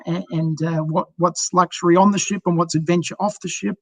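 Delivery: ordinary talk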